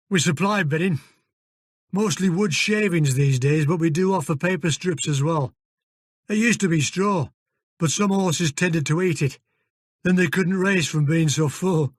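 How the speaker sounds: put-on broad northern English accent; gruff Yorkshire-style stable manager. Fergus voiced in northern accent.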